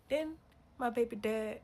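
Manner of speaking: ordinary talk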